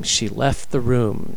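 ordinary delivery, nothing marked

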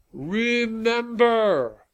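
long, high, drawn-out shout; shouting over cheering. A narrator's reading. Rally leader to the cheering crowd, shouting over them. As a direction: stretch the word out to carry over a crowd. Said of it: "Malcolm shouted over the cheering".

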